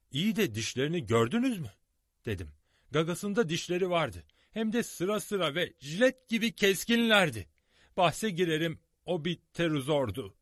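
high, loud, eager; excited. A narrator's reading. Narrator excitedly telling the others about the teeth, high and loud. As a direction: burst out with the discovery.

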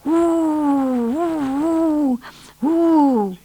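high, eerie crone wailing at the wind; old snow witch. Acted. Old witch goading her wolves through the blizzard, high eerie howling. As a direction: howl it out like a cruel old witch urging the storm.

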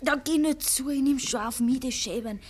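urging. Acted. Drängt ihn hinaus.

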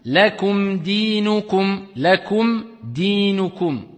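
slow lakum dinukum, the final mim of lakum said clearly without a held nasal; tajwid recitation. Shown as a demonstration. Teacher gives a second example, slow recitation. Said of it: "Ou bien".

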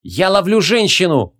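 high, drawn-out call; shouting. Acted. Rider diving after the woman, calling out. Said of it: "крикнул Логран".

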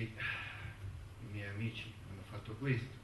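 ordinary talk, nothing marked

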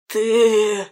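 hoarse, angry wounded man; angry rasp. A narrator's reading. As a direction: rasp it out in anger. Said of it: "гневно прохрипел он".

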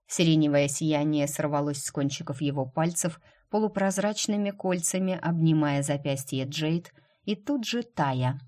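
ordinary delivery

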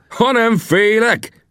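very high, drawn-out incredulous roar; acted bellow, pitch far up. A narrator's reading. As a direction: stretch it out as an outraged howl. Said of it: "üvöltötte".

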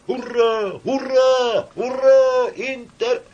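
high, ringing drawn-out cheers; crowd hurrah. A narrator's reading. Crowd hailing the orator, high loud cheering. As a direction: cheer it out, then start the anthem.

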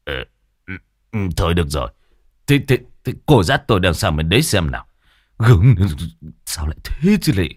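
lightly voiced old man, grumbling; a hint of an old man giving in; reluctant old man. Old Cẩm agrees to go, lightly voiced grumbling old man.

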